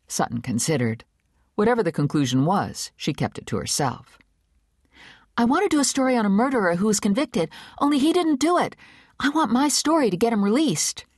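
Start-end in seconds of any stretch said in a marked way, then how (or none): 5.4-11.1 put-on higher, quick eager young woman; young Rune, eager. A narrator's reading. Young reporter pitching her idea to the big boss, higher and eager. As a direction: pitch it fast and hopeful, a little nervous.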